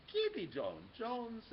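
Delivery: slow, high scolding call. A narrator's reading. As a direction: call his full name out. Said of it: "everyone cried".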